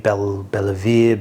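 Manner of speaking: slow, unsure "Bellevier" with a foreign-sounding twist; fumbling French name. Shown as a demonstration. Reviewer stumbling over a French whiskey name, slow and unsure. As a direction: try out "Bellevier" carefully, syllable by syllable. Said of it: "I cannot pronounce French words".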